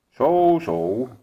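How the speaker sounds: high, loud startled zo; jolted awake by a stranger; surprised old king. A narrator's reading. Old king startled by the wolf, high and loud.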